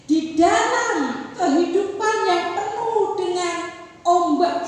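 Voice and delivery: loud, high, slow sermon lines; fervent slow preaching; full-force preaching. Acted. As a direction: drive each phrase home slowly.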